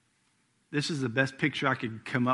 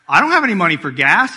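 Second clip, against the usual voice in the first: higher, louder mock whine for gas money; loud mock plea; 6 semitones higher, 15 dB louder than usual. Preacher retelling asking dad for gas money, loud mock plea. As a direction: belt it out like a kid asking for cash. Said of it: "and I'm like".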